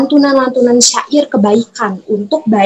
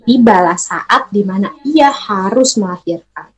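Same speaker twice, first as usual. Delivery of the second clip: usual speaking voice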